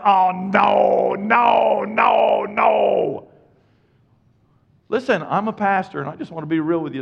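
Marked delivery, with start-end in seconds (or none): 0.0-3.3 high, loud mock protest of no; reluctant refusal. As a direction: shout the no's like a dodging excuse. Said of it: "what's gonna come out of your mouth".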